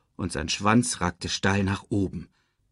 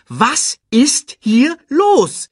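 high, loud, slow word by word; insistent repeat; 8 dB louder, much slower than usual. A narrator's reading. Timon repeats his question, slow and insistent. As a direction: space out every word, demanding an answer. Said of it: "Ich wiederhole".

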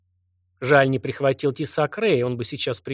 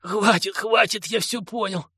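hoarse yell; 7 semitones higher than usual. Acted. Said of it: "наркоторговец хрипло заорал".

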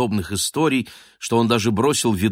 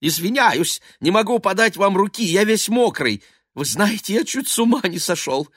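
much higher, rushing nervous talk; excited babble; 11 semitones higher than usual. A narrator's reading.

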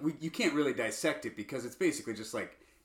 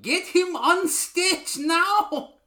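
put-on much higher, slow, odd drawn-out accent; Tom Hanks as Colonel Parker; 7 dB louder, much slower than usual. Mocking Tom Hanks's odd accent for a friend, slow and high. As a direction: stretch each word in a strange put-on voice. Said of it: "Tom Hanks will pop up and go".

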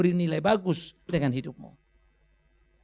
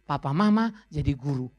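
ordinary delivery

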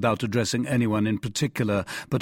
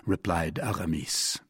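plain reading voice

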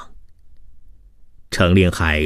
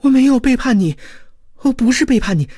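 high, desperate woman's shriek; 3 dB louder, faster than usual. A narrator's reading. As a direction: protest shrilly in sheer panic. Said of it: "曹媚娘尖叫道". (惊恐地尖叫辩白)

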